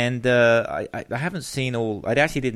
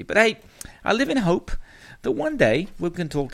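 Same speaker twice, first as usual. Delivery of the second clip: bright and lifted, higher and louder; upbeat hope; 11 semitones higher, 4 dB louder than usual. Real, not acted. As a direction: toss it off with cheerful optimism. Upbeat aside after complaint.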